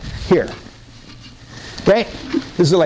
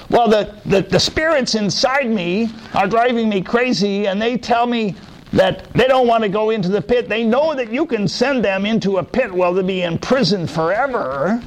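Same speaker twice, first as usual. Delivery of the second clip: higher pleading voice; tormented man; 6 semitones higher than usual. Acted. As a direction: beg for the spirits. Possessed man explains.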